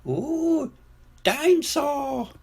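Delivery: put-on very high, drawn-out little-child voice; awed toddler. A narrator's reading. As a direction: coo in delight like a tiny boy. Small George sees the toy.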